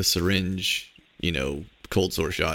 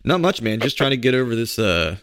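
ordinary talk

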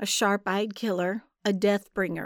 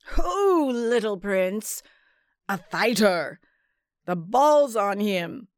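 big hearty shout, ho pitched way up; roaring sailor; 4 dB louder, slower than usual. A narrator's reading. Sailor in the tavern bellowing at the scrapping cat. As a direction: bellow across the tavern at the fighter.

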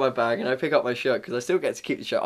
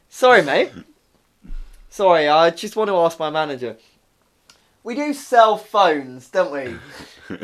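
sarcastic; 3 semitones higher, 6 dB louder than usual. Retold or imitated. Assistant asking his manager before a customer, loudly sarcastic. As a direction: play dumb with exaggerated politeness. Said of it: "no need to get sarcastic".

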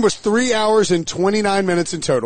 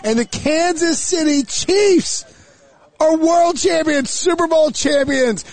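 podcast show opener; 7 semitones higher than usual. Acted. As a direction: proclaim it like a big-game announcer. Podcast host opens the show.

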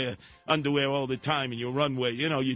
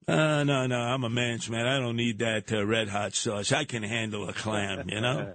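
mock macho; 7 semitones lower than usual. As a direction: boast like a man's man. Macho man's man bravado.

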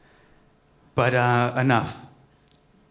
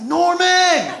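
much higher, loud cheering call; crowd cheer; 9 dB louder than usual. Retold or imitated. Comedians rising to greet Norman Lear, loud cheer. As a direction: cheer his name like a standing crowd. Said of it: "They were just like".

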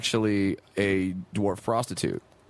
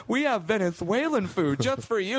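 higher-pitched mock parent voice; girlfriend's parents; 9 semitones higher than usual. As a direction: put on an eagerly hosting parent.

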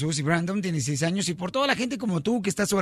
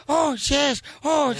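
mock moaning; 3 semitones higher, much slower than usual. Punchline moan.

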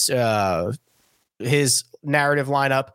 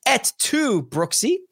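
mock Shakespearean recital; 6 semitones higher than usual. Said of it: "Typical Shakespearean tragedy".

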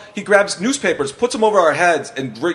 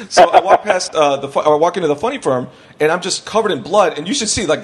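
ordinary talk

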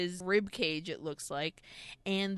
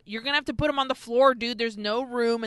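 hurried coroner; 4 semitones higher, 5 dB louder, faster than usual. Retold or imitated. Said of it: "and he's like".

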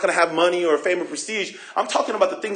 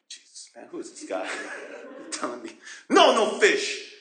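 low, hushed grumbling with a laugh; irritated disciple muttering; muttered grumble; 6 semitones lower, 4 dB quieter than usual. Retold or imitated. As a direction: mumble resentfully under your breath. Grumbling at the stranger.

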